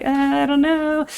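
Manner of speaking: high, stretched-out uneasy waver; mock hesitation. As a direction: drag it out high, playfully reluctant. Voices own wary hesitation about flying.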